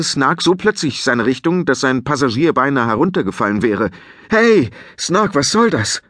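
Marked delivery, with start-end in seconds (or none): 4.3-6.0 much higher, alarmed shout; startled higher outburst; startled cry. Acted. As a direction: caught off guard mid-flight. Nearly thrown off the dragon.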